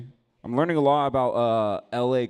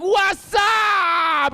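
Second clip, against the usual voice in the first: much higher, louder hammed-up yell; mock shout; 11 dB louder than usual. Jumping into the fight, much higher hammed-up yell. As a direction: bellow it, joining in.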